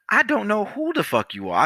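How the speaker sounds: high-pitched acted-out indignation; retold heated comeback; indignant retort. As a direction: fire back, pitch way up. Acted angry reply to customer.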